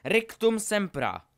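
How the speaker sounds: loud, high, slow incantation; roared spell. A narrator's reading. Harry retaliates against Draco, roaring. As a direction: roar the spell back in return. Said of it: "zařval Harry na oplátku".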